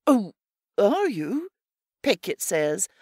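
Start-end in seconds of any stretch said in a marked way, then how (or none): 0.1-1.5 a bit surprised. A narrator's reading. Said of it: "a bit surprised".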